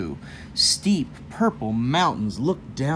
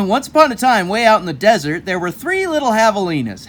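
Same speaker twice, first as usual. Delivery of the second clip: grand storyteller opening; 6 semitones higher, 8 dB louder, faster than usual. A narrator's reading. Restarting the story with flourish.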